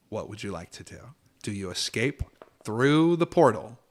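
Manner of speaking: hushed, unhurried scene setting; game master narration. Acted. GM to players, setting the death scene, hushed. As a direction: draw the players into the dark.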